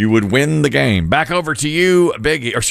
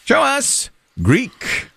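high, slow, drawn-out announcer call; drawn-out, high board reveal; game-show reveal call; 12 semitones higher, much slower than usual. Pretend. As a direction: stretch the answer out like a game-show host. Game-show host calling for reveal.

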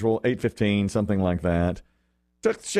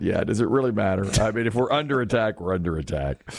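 unbothered, sinking lower at the end; resigned indifference; 3 semitones lower than usual. Retold or imitated. Husband answering his alarmed wife in bed, resigned and low. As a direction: shrug it off, letting the voice drop.

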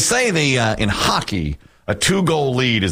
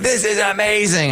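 gushing impression; 11 semitones higher than usual. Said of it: "Biggie's like".

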